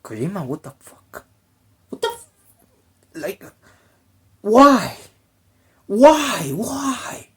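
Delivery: rising incredulous why; mock outrage. Fan asking Kojima why, in mock outraged disbelief. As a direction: climb into disbelieving cries. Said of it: "And you're like".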